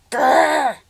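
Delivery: loud high snarling growl; witch growl. A narrator's reading. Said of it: "said Baba Yaga".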